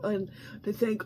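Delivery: ordinary talk, nothing marked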